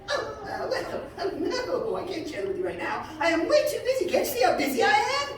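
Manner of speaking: high, hurried, dismissive hare voice; busy hare. A narrator's reading. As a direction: wave her off like a busy little hare. Hare brushes Sifa off.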